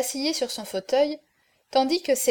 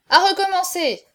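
high, loud call for a replay; raised protest from the supporters; shouted demand; 7 semitones higher, 6 dB louder than usual. A narrator's reading. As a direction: cry out for a fresh start. Supporters demand a new round.

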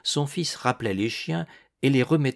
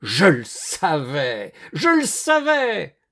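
loud, drawn-out howl climbing higher; triumphant yell; 6 semitones higher, 5 dB louder, slower than usual. A narrator's reading. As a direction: yell it, higher the second time. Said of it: "hurla monsieur Magnon".

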